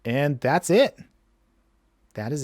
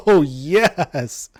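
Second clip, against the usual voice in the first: gleeful laugh; 3 semitones higher, 7 dB louder than usual. Real, not acted. Combo going off, gleeful.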